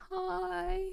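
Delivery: high, soft drawn-out hi; small, high innocent greeting; sheepish greeting. Retold or imitated. Driver greeting the cop who pulled her over, sheepish.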